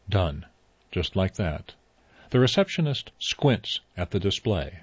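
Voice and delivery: ordinary delivery, nothing marked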